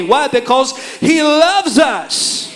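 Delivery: ordinary talk, nothing marked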